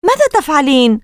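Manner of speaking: high, alarmed question. Acted. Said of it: "قال كيسي بصوت خائف ومرتفع".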